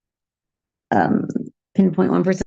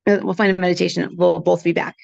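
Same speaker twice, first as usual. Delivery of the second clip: usual speaking voice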